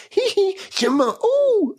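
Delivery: very high falsetto yelps. Retold or imitated. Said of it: "He's like".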